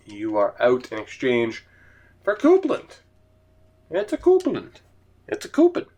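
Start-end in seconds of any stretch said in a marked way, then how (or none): 2.2-5.9 high-pitched mock call. Mock high announcer of name.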